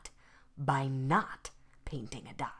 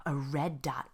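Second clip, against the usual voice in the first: plain reading voice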